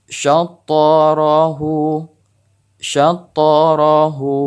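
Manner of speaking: slow "syatarahu" with the t bouncing; qalqalah on ta. Shown as a demonstration. Teacher demonstrating qalqalah, bounced ta. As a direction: let the ta in "syatarahu" rebound, slowly. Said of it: "pantulan to ini terdengar".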